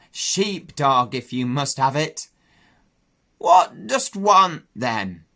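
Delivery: put-on an old country sheep croaking; Ma the old ewe questioning the pig. Ma speaking.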